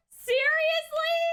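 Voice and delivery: high, drawn-out disbelief; indignant. Real, not acted. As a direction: stretch it in outrage. Indignant reaction.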